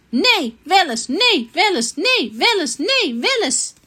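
high, bouncing back-and-forth; sing-song bickering. Acted. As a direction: chant the contradictions like a playground squabble. Demonstrates their cosy back-and-forth bickering.

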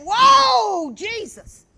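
loud startled yelp; startled cry. Retold or imitated. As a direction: jump at the shock and cry out. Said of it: "He went".

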